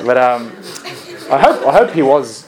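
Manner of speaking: laughing while talking. Real, not acted. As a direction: keep laughing as you speak. Still laughing at the story.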